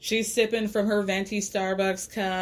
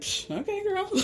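higher, softer sarcastic brush-off; dismissive scoff; 10 semitones higher, 4 dB quieter than usual. Retold or imitated. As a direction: scoff at her sister's plan. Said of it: "Leah's just like".

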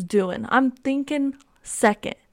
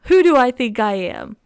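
slow, loud, awestruck; mock amazement; 3 semitones higher, 7 dB louder than usual. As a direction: marvel at yourself, half joking. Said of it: "I'm like".

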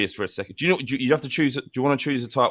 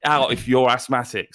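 usual speaking voice

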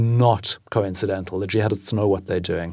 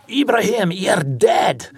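high-pitched imitation of a Glaswegian soldier; mock Glaswegian battlefield call; Glaswegian accent; 9 semitones higher, 3 dB louder, much slower than usual. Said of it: "Suddenly you hear this Glaswegian say".

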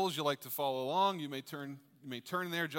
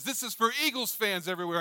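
higher, louder hammed-up rallying cry; mock battle cry; 8 semitones higher, 6 dB louder than usual. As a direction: shout it like a triumphant fan. Eagles fan's rallying cry.

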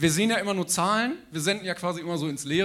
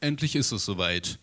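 booming stage introduction building to a shout; grand entrance announcement; 5 semitones lower, 7 dB louder than usual. Acted. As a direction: hype up the guest's entrance.

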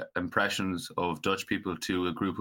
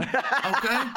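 high laughing okay; laughing protest; 13 semitones higher than usual. Real, not acted. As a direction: squeak it out defensively.

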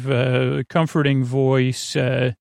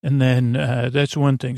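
ordinary delivery

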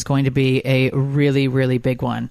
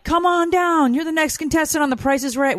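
high-pitched mock game-show announcer; super animated impression; 12 semitones higher than usual. Wife teasing husband about his tone, super animated. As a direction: imitate an over-excited contestant call, pitched way up. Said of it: "you're doing, like".

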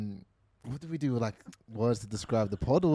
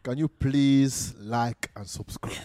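slow, deliberate Nigerian dad voice; dad's Nigerian accent; slower than usual. Acted. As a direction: imitate a Nigerian father addressing the fans. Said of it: "imagine it's your dad doing it".

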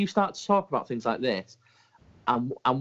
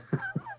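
a quick quiet giggle; soft high laugh; 8 dB quieter than usual. Real, not acted. Laughing quietly at the cohost's Irish accent try. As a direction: laughs under his breath.

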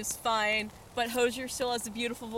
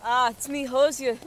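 put-on a weak try at an Irish accent, a little louder; bad Irish accent; 6 dB louder than usual. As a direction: fake Irish lilt, barely there. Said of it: "That was not an Irish accent".

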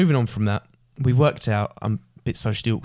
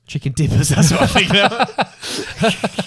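high, loud words broken by laughter; laughing; 7 semitones higher, 5 dB louder, much slower than usual. Real, not acted. Cracking up at the chicken dippers joke, laughing.

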